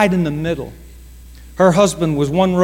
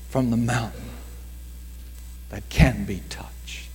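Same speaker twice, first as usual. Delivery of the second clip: slow, softer, sinking to a whisper; soft sermon close; 6 semitones lower, 7 dB quieter, slower than usual. Real, not acted. Preacher closing the sermon to the church, slow and hushed. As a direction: draw the congregation in quietly.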